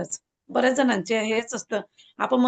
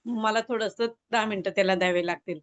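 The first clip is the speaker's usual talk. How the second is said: normal talking voice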